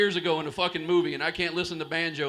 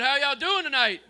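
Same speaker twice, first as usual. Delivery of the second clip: much louder, higher greeting; louder second try at the crowd greeting; louder repeat; 8 semitones higher, 8 dB louder than usual. Real, not acted. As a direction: shout it again to wake them up. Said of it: "I said".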